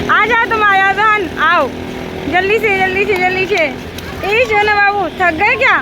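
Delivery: eagerly calling the little one over, coaxing; mother calling Johan to come quickly, high and eager. Real, not acted.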